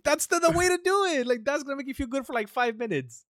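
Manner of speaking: high, loud, fast scolding reply; exasperated retort. Retold or imitated. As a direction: lecture them in disbelief. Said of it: "I'm like".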